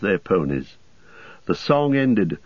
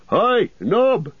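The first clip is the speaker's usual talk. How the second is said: high shout across the inn; calling out shout; 13 semitones higher, 3 dB louder than usual. A narrator's reading. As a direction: call the servant loudly. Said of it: "he shouted".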